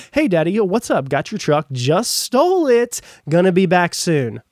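loud, high, hammed-up carefree answer to dad; sarcastic sing-song voice of a guilty son pretending all is fine; mock cheery son. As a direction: play the breezy son who just stole the truck, loudly and high. Mock call answering dad about truck.

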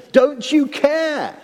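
high, slow reproachful cry; accusing wail, half question half charge. Acted. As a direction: throw it at him as an accusation. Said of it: "partly it's more of an accusation".

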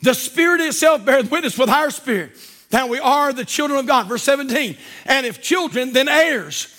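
loud, slow preaching of scripture; scripture reading. Acted. Preacher to congregation, loud solemn scripture reading. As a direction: proclaim the verses to the church. Preacher reads Romans aloud.

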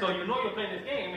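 ordinary talk, nothing marked

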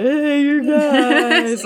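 loud, slow, high Goonies Sloth call of "Hey, you guys". Retold or imitated. Fan quoting the Goonies every time, Sloth impression. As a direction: bellow it slowly like Sloth from the Goonies. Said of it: "in sloth voice".

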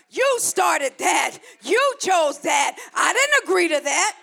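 loud, forceful preaching as God; stern divine rebuke. Acted. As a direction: scold them hard, punching each phrase. Preacher voices God rebuking the walker.

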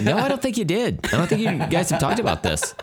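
high, laughing fake denial; mock gaslighting, laughing. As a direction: deny it while giggling. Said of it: "gaslight them into being like".

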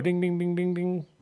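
high chiming ding dings; mock game-show bell. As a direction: ring it out like a quiz bell.

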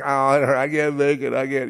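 high mock whimper of a scared man; whimpering wimp. Mocking the hikers in the film, whimpering wimp. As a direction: whine it like a weakling who gives up. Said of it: "like real wimps".

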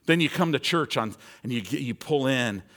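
normal talking voice

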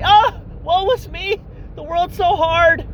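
milk the self-pity like a tragic martyr; mock self-pity. Mocking complainers with a high, slow self-pitying whine.

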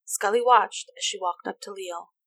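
usual speaking voice

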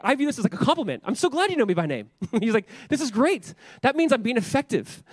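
giddy at being targeted; thrilled. Retold or imitated. Retold friend delighted by attack.

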